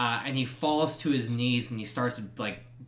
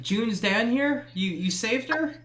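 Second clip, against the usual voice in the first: Edwin hoping; 9 semitones higher than usual. Acted. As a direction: ask it as if you dare not believe. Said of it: "I said".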